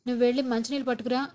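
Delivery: usual speaking voice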